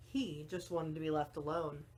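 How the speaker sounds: ordinary delivery, nothing marked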